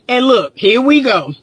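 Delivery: usual speaking voice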